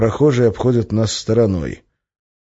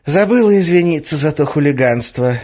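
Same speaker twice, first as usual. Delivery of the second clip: breezy, higher-pitched apology; unabashed; 8 semitones higher, 4 dB louder than usual. A narrator's reading. Witch owns up to the stolen drive, unabashed. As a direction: apologise without a trace of embarrassment. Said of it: "ничуть не смутившись".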